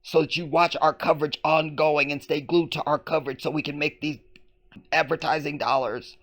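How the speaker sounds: high-pitched parody of a newscast; mock reporter voice. Host parodies reporter keeping viewers glued, high mock newscast. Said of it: "The seduction of the report".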